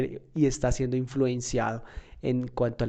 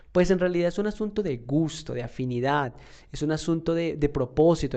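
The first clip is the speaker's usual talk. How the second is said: ordinary talk, nothing marked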